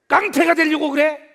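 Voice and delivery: 깡패가 shouted high and loud; loud scolding. Retold or imitated. Mother yelling 깡패가 되려고 그래 at her sons. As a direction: yell 깡패가 되려고 그래 at the boys. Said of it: "그 다음 말이 내 마음에 너무 아픈 거야".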